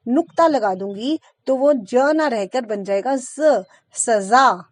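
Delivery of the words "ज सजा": "सजा" with a z sound, slow; nukta z sound. Shown as a demonstration. Teacher shows the nukta word meaning punishment, careful. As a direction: say "सजा" as saza with z. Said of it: "ज के नीचे बिंदु लगा दूंगी".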